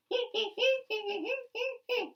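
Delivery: high, squeaky monkey laugh; cheeky monkey cackle; monkey chatter. Acted. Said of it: "monkeys ... said".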